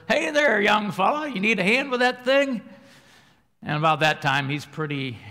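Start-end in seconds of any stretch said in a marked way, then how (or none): 0.1-2.6 put-on high, loud cheery old man calling over. Old driver calling to stuck motorist, high and cheery. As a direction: play a folksy old guy offering help. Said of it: "he says".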